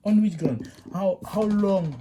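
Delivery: exasperated. Real, not acted. Teacher scolds wrong method.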